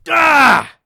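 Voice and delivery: loud sharp cry of pain. Acted. Hero resets his shoulder against the door frame, loud pain cry. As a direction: yell as the shoulder pops in. Putting shoulder back in socket.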